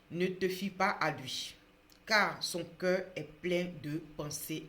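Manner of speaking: loud, slow, solemn proclamation; Bible reading. Acted. Preacher reading a proverb to viewers, loud solemn reading. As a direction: read scripture out with weight, pausing between phrases. Reads Bible verse aloud.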